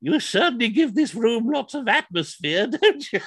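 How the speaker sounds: put-on high, prim old lady teasing; sweet old lady. Old lady tells Henry he gives the room atmosphere, high and prim. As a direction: pat his hand and tease in a high old voice. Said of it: "she said".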